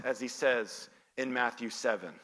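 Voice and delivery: ordinary talk, nothing marked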